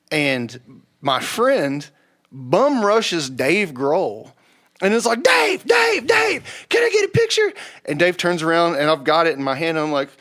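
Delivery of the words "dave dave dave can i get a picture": high, loud fan calling out; shouting to a celebrity. Retold or imitated. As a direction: yell his name across the sidewalk. Fan shouting at [name].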